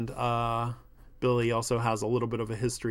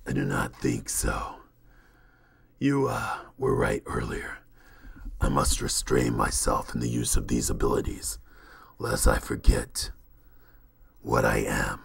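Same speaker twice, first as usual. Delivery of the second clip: low rumbling supernatural being; brooding creature; 13 semitones lower than usual. Acted. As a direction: speak from the depths, heavy and halting.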